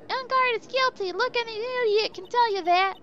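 high, loud, indignant onlooker; shrill courtroom gossip ranting; mock crowd rant. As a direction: jeer it out like a smug know-it-all. Ranting the defendant is guilty.

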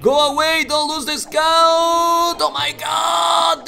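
high, frantic commentary rising to a drawn-out shout; frantic alarm. Real, not acted. Commentator warning a player about losing the scout, frantic.

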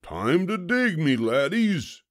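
high-pitched captain's order; commanding pirate. A narrator's reading. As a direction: command your pirate crew. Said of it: "commanded Braidbeard".

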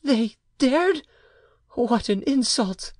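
high woman's voice, outraged; indignant mother. A narrator's reading. Mother outraged at the name her child was called, indignantly. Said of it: "became purple with indignation".

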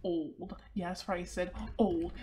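ordinary delivery

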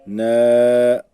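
Ne pushed out loud with a tight throat; tensed throat. Shown as a demonstration. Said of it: "boğazımızı kasarak".

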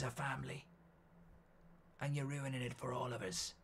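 soft, hushed Irish accent impression. Shown as a demonstration. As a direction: put on a quiet, brooding Irish voice. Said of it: "I was doing an Irish accent".

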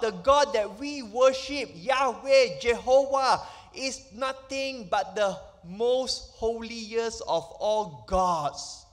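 normal talking voice

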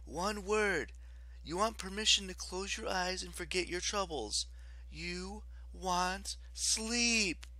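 high, gentle, hypnotic lulling; soothing. A narrator's reading. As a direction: stretch the last words to send her to sleep. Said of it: "Piper said soothingly".

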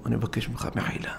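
whispered shamed apology; hushed, shamed plea for forgiveness; ashamed whisper. Retold or imitated. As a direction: barely breathe the apology.

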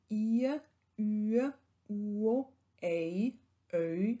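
I, Ü, U, Ei sounded as gliding two-part vowels, first part stronger; diphthong demo. Shown as a demonstration. Lecturer shows students the Middle High German diphthongs, gliding. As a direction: model each vowel as a diphthong. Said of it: "als Diphthonge gesprochen".

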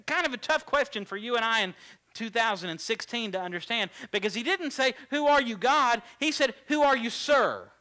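loud, high-pitched sermon delivery, peaking in shouted quotes; full-force preaching. Real, not acted. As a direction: preach it at the top of your voice.

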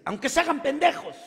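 mother shouting at nobody in particular; mother raises her voice while cleaning, loud and high; mother yelling. Retold or imitated. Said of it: "subió de tono su terapia".

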